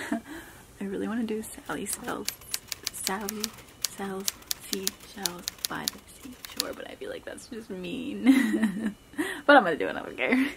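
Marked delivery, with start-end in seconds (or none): 0.1-8.2 very soft, near-whispered secret; hushed aside. Real, not acted. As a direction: confide the tongue twister under your breath. Secretly telling viewers the phrase.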